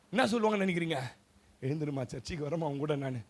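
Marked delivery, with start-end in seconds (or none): 1.6-3.2 low, soft acted reply; quiet giving in. Parent agrees to come to church with weeping child, quiet giving in. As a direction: drop right down, softly agreeing.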